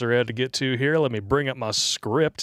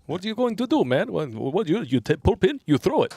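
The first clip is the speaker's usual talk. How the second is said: higher-pitched put-on comrade voice; acted soldier banter; 6 semitones higher than usual. Host as a comrade nagging Ivan to use the weapon, put-on voice. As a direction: play an exasperated comrade, pitched up.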